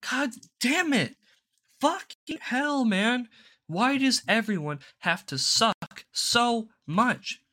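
high, exasperated cursing rant; real frustration. Real, not acted. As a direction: vent at everyone, fed up. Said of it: "I'm just pissed".

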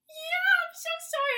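very high, wailing apology; mock crying. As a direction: whimper it like a weepy child.

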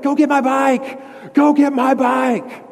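put-on high, slow childish yell; little kid demanding his bike back. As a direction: shout like an angry second-grader. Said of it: "and I'm like".

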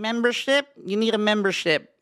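high, loud, demanding Karen voice; loud high-pitched Karen. Shown as a demonstration. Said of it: "Can you do the Karen one?".

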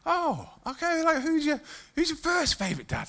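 high, soft, over-sweet question; fake calm. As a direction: ask with badly faked calm sensitivity. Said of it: "totally sensitively and calmly just asked him".

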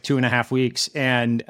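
usual speaking voice

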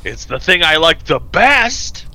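high, drawn-out mock whine; wailing toddler. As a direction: whine it like an overwhelmed child. Continues the wailing toddler bit.

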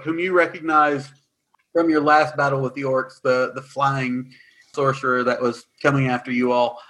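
ordinary talk

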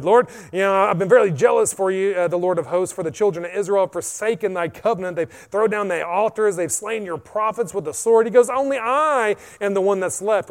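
pleading complaint. Acted. Elijah complaining to the Lord, loud and pleading. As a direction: plead your case, aggrieved.

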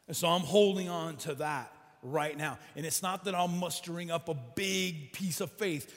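loud, rising sermon climax; preaching at full force. Real, not acted. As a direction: drive it home to the congregation.

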